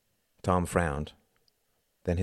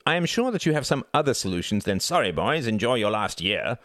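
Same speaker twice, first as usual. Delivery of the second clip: loud, high, haughty son; arrogant young son; 5 semitones higher, 3 dB louder than usual. Acted. Arrogant son lecturing his father, loud and high. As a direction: sneer down at everyone.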